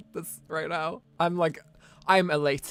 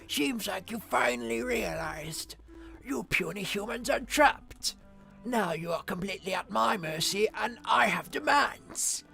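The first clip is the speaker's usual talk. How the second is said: put-on gloating old dragon, pompous; grumpy old man; 4 semitones higher than usual. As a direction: lord it over them like an old tyrant. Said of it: "The old man voice of Ragnar".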